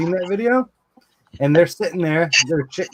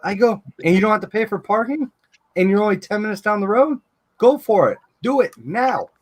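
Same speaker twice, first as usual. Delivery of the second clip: eager urging; 6 semitones higher than usual. Retold or imitated. Urging wife to take job.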